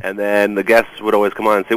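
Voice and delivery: usual speaking voice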